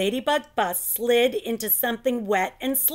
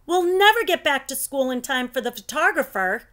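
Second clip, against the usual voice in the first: plain reading voice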